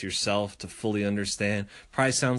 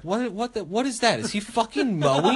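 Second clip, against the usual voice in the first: imitating wife; 9 semitones higher than usual. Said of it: "She's like".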